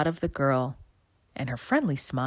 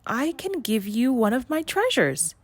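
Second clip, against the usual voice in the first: put-on higher, eager girlish voice; eager young mermaid; 7 semitones higher, 3 dB louder than usual. A narrator's reading. Melody pleading with the witch, higher and eager. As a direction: offer your treasures eagerly.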